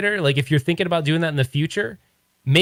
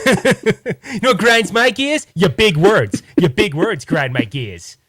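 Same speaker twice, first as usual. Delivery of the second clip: Peter Griffin; 3 semitones higher, 3 dB louder than usual. Acted. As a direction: bluster like an offended cartoon dad. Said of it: "turn into Peter Griffin".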